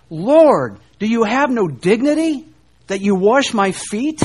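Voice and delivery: loud, high, appalled protest; shocked disciple, voice climbing on dignity; indignant disbelief. Retold or imitated. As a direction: ask it as if the master has lost all dignity. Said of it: "It might be".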